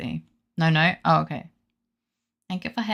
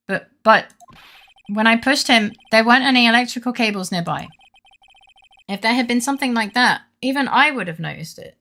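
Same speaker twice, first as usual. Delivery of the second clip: higher, louder, hurried protest; flustered protest; 5 semitones higher, 6 dB louder than usual. Acted. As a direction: blurt the defense in a panic. Reads game dialogue aloud.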